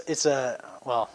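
ordinary talk

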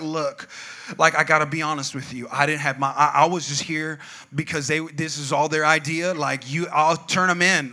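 low, confidential bargaining; lightly acted earnest plea of innocence; negotiating. Friend bartering with police, low negotiating voice. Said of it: "trying to, like, negotiate".

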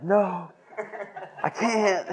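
high, pleading mock whine; laughing high-pitched whine; whiny protest. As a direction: play your younger self begging off. Retelling his own pleading reply.

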